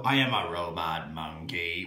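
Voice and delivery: low, soft robotic monotone; a flat robot monkey voice. Acted.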